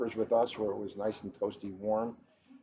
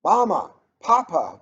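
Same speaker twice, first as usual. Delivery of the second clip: called out louder and higher; a child's joyful first call; cried out; 9 semitones higher, 10 dB louder than usual. Acted. Boy calling to his parents with his first words, cried out. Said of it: "the boy cried".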